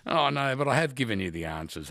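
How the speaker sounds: ordinary talk, nothing marked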